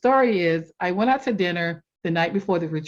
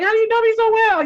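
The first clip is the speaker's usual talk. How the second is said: much higher, louder and quick; amazed; 7 dB louder, faster than usual. Retold or imitated. Asking the coach in retold delight, amazed and fast. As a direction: blurt it out in delighted disbelief.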